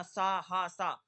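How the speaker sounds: सा, हा pushed out loud; forceful demo. Shown as a demonstration. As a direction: say it with effort. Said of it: "थोड़ा ताकत के साथ बोलना पड़ेगा". (ताकत के साथ उच्चारण)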